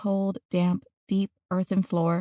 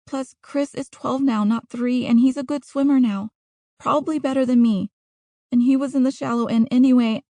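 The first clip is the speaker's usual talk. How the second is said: put-on higher-pitched girl, explaining herself earnestly; Natalia reciting her letter; 4 semitones higher, 4 dB louder than usual. Trapped girl recites her letter to Mom and Dad, defensive girl's voice.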